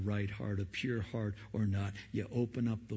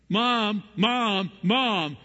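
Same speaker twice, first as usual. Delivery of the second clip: louder, higher repeated whiny calls; mock kids nagging; 8 semitones higher, 9 dB louder, much slower than usual. Preacher mimics kids calling mom repeatedly, mock whine. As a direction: nag like a kid tugging at mom.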